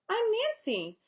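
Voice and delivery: put-on high, bright little-girl voice; perky schoolgirl introducing herself; Nancy's voice. A young girl introducing herself, pitched high.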